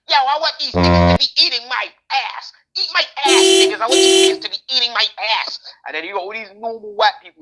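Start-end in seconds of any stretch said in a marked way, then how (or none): 0.1-5.6 loud, high-pitched brash American impression; bold American accent. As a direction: belt it out like a big bold American. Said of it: "with a big bold American".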